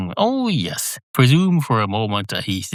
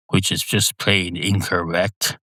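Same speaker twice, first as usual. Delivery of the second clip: ordinary talk, nothing marked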